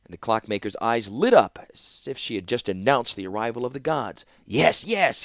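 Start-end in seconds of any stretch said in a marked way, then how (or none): 4.5-5.2 eager yes, higher and louder; emphatic. A narrator's reading. Clockmaker answering the princess, emphatic and eager. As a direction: insist with delight. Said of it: "he said emphatically".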